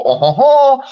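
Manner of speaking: high, loud mock lament; mock French mourning. Mocking French film characters mourning, high hammed-up wail. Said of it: "they go".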